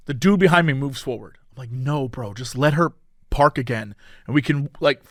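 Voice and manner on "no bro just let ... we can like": low, soft pleading; quiet plea. Retold or imitated. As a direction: beg him under your breath. Pleading with the driver behind.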